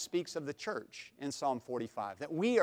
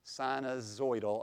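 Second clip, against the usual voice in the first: usual speaking voice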